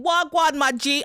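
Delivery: mock street-slang greeting to a customer. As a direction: lightly imitate a casual street greeting. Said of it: "customers saying".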